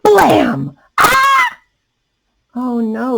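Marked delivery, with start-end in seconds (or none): 0.0-0.8 shouted, high sound word; loud blam. A narrator's reading. Reader acting the popping balloon, loud shout. 1.0-1.6 high loud yelp; startled cry. A narrator's reading. Reader reacting to the pop with a high yelp.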